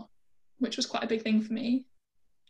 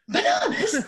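put-on much higher, louder delighted kid; excited child; 11 semitones higher, 4 dB louder, slower than usual. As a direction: squeal it like a thrilled little boy. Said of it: "My son ... is like".

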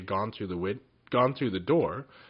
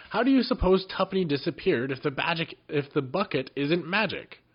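higher and louder, exasperated; impatient Jinx; 8 semitones higher, 4 dB louder than usual. A narrator's reading. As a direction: push back in exasperation.

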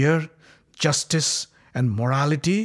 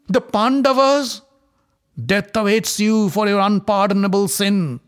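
higher and louder angry rebuke; cried out; 8 semitones higher, 6 dB louder than usual. Acted. Dhritarashtra rebuking Duryodhana, loud anger. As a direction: thunder at your foolish son. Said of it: "Dhritarashtra cried out".

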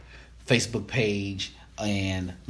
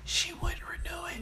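hushed whisper, higher; 8 dB quieter than usual. Real, not acted. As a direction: breathe the words out unvoiced. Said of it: "Why are you whispering?".

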